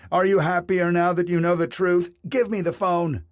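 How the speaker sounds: husband shouting at his wife for the phone; angry shouting. A narrator's reading. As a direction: snap back at her in fury. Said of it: "Stanton shouted".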